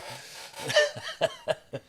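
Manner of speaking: high laugh. Real, not acted. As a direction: burst out laughing. Big laugh.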